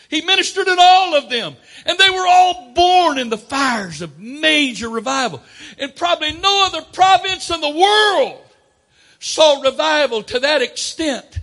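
loud, high, impassioned preaching; full-force preaching. Real, not acted. Preacher telling the church of major revival, full-force preaching. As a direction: preach it out at full voice, driving each point home.

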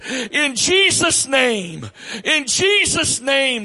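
loud, high fervent prayer; preaching prayer. Real, not acted. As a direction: cry out in prayer. Preacher praying at full force.

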